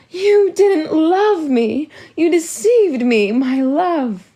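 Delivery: high, loud, slow wail. Acted. Wife to deceiving husband, wailing. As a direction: sob out the accusation. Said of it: "Mum Olga's wails".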